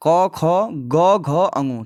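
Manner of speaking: each letter said slowly and clearly; reciting the consonants. Shown as a demonstration. Teacher to students, demonstrating ka-varga letters slowly. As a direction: sound out क, ख, ग, घ, अंगु one by one from the throat. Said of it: "तो ये क्या होता है".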